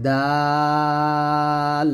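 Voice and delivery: দাল held long, tongue tip at the upper teeth roots; Arabic letter dal. Shown as a demonstration. Teacher demonstrating দাল for learners, slow and held. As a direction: draw দাল out in a steady Arabic recitation tone. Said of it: "জিহ্বার আগা সামনের ... লাগিয়ে দাল".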